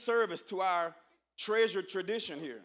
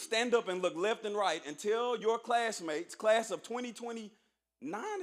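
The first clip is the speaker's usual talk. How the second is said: normal talking voice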